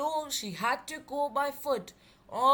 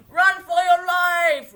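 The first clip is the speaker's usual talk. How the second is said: loud, slow, much higher panicked yell; urgent shout; 8 semitones higher, 14 dB louder than usual. A narrator's reading. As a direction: shout a warning while fleeing. Scientist fleeing erupting volcano.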